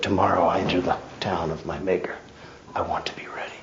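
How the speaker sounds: quick then fading to a whisper; solemn quote. Acted. Preacher quoting Pilgrim's Progress in a hushed voice. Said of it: "As Pilgrim's Progress said".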